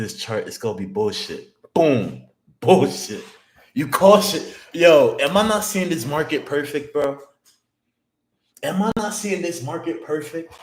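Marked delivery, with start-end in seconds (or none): 1.7-10.5 much higher and louder, euphoric yelling; hyped shouting. Real, not acted. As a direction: yell like you just nailed every call. Hyped over reading market perfectly.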